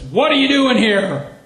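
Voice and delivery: big tough guy yelling in my face; menacing shout. Retold or imitated. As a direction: bark at the doctor from above. Big gang man confronts doctor.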